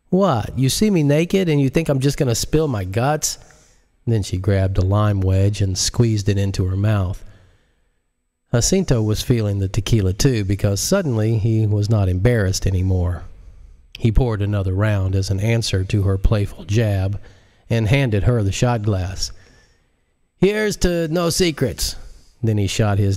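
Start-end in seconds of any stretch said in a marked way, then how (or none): 0.1-3.4 higher, teasing; a light teasing character voice; playful Marise. Acted. 20.4-21.9 higher, slow toast; slow raised-voice toast; toasting Jacinto. Acted.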